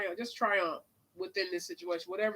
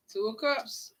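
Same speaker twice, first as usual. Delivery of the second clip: ordinary delivery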